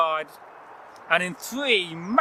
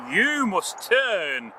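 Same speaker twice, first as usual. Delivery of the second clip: very slow solemn spell; slow incantation; much slower than usual. Acted. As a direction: intone it like a spell. Cow's magic words.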